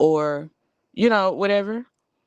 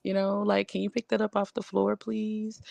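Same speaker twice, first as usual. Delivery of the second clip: a touch higher, softer sweet asking; real nice; 4 semitones higher, 5 dB quieter than usual. Shown as a demonstration. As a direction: coax daddy gently and softly. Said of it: "You gotta talk to him real nice".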